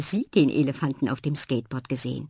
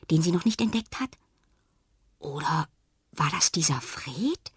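ordinary delivery, nothing marked

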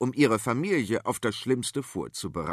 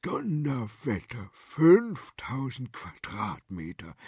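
impressed king; slower than usual. Acted. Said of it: "sagte Ladislaus der Letzte".

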